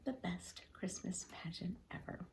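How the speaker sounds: hushed whisper, like sharing a secret; whispered title. Acted. Teacher whispers book title.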